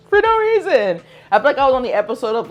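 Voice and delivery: high, loud laughing repeat, rushing on. Real, not acted. To her viewers about the coffee spill, laughing hard. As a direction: laugh through it at the absurdity.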